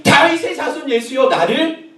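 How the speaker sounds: high, insistent plea; crying out. Acted. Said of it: "소리 질렀다니까요".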